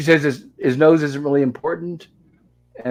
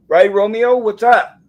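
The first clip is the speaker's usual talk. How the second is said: higher, louder sing-song voice for the pet dog; talking to the dog; 10 semitones higher, 8 dB louder, slower than usual. Real, not acted. Owner calls to her dog Romeo, high and louder.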